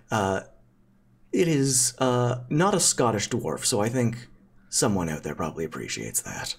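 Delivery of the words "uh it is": normal talking voice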